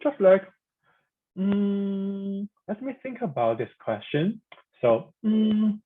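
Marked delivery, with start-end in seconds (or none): 1.4-2.5 long "mm" held level and high; flat tone. Shown as a demonstration. Teacher thinking about a question, flat tone. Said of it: "it's the flat tone". 5.2-5.8 "mm" sustained on a high level note; flat tone. Shown as a demonstration. Teacher naming the first tone, flat tone. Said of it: "it's the flat tone".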